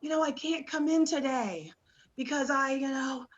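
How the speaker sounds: faking a sick voice on the phone; fake sick call. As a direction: put on a weak sick voice. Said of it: "It would be like".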